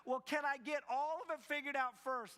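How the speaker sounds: high, loud mock-whiny plea; a hammed-up high whine asking to know everything first; pleading bargaining. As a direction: beg to skip ahead like a nervous kid. Believer voiced bargaining with God.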